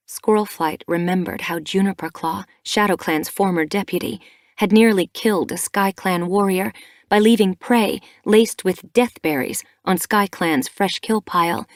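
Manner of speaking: usual speaking voice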